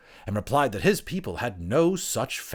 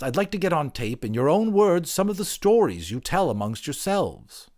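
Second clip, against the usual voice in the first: higher, keen interviewer voice; eager anthropologist; 9 semitones higher, 5 dB louder than usual. Acted. Anthropologist asking the creature for its stories, eager and higher. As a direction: coax the stories out politely.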